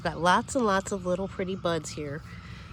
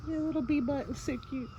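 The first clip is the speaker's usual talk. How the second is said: soft, higher cutesy voice for a bee; cooing at a bee; 7 semitones higher, 5 dB quieter than usual. Real, not acted. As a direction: coo to the bee like a pet.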